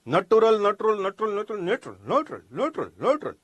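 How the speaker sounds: rapid repeats slurring નટુરલ down to નેચરલ; swallowed syllables. Retold or imitated. Mimicking speakers who never finish the word, swallowed syllables. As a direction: wear the word down, dropping its middle syllable. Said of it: "ઈ તો પૂરું બોલતા જ નથી".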